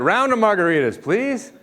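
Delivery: hammed-up cheer building to a laughing high please; mock excited order. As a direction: shout the order for a round like a party starter. Customer ordering round, building.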